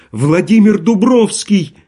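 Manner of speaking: high, loud, slow desperate cry; in despair. A narrator's reading. Daughter cries out her rescuer's name, despairing. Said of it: "отвечала Маша в отчаянии".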